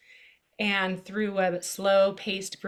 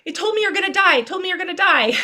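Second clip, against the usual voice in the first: put-on high, loud, panicked child's cry; frightened boy, high and loud, sure his mum will die; scared child; 11 semitones higher, 11 dB louder, much faster than usual. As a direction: echo a frightened kid's alarm, high and loud. Son's scared quote of heart.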